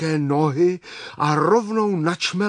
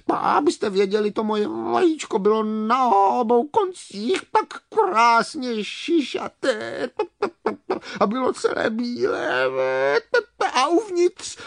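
high, proud clucking hen; hen beginning to smile; 8 semitones higher than usual. Acted. Hen describing her lovely egg, slightly smiling. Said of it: "začala se dokonce malinko usmívat".

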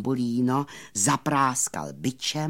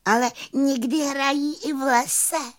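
very high fairy voice with pride; proud little fairy; 13 semitones higher than usual. A narrator's reading. Fairy boasting about her friends, high proud voice. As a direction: add it proudly in a tiny voice. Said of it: "dodala pyšně plavůňka".

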